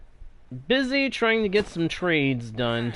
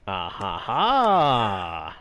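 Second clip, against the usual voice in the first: mock villain laugh; 7 semitones lower, much slower than usual. Gloating at a good trade.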